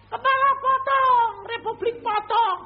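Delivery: high, loud slow menacing soldier's shout; mock militia yell. Rebel soldier threatening the preacher, loud slow shout. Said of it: "Aku ya teriak gitu".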